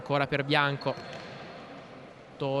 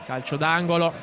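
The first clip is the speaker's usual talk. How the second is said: usual speaking voice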